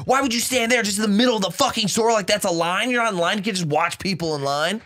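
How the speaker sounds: high ranting yell at a stranger; angry screaming. Retold or imitated. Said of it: "just completely like".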